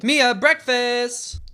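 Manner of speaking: high, drawn-out singsong call; mum calling out. Pretend. Mother calls Mia to breakfast, high singsong. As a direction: call the kid down to breakfast, stretched long.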